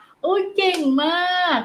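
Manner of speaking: put-on high, slow delighted praise for a toddler; cheerful praise. Shown as a demonstration. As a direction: praise the child warmly, drawn out. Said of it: "เราก็ชมก่อนค่ะ". (ชมลูกด้วยเสียงสูงช้าๆ)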